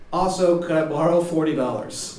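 high, loud note voice; note read aloud. Acted.

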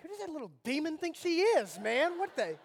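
hammed-up protective-dad fury; mock outrage. Dad fuming at the girl who snubbed his daughter, mock outrage. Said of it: "And I'm like".

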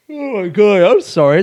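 talking through a yawn. Real, not acted. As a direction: yawn while speaking. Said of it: "It's fucking yawntopia over here today".

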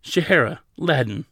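mildly stern, firm answer. A narrator's reading. Counsel answering a nervous attendant, stern. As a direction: give the name with authority. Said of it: "Zade answered sternly".

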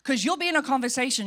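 ordinary talk

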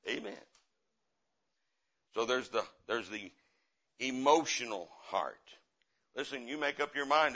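ordinary delivery

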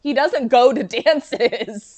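slow, loud, laughing through it. Real, not acted. Co-host laughing at Martin's broken hip, slow and loud. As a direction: let the laugh break the line.

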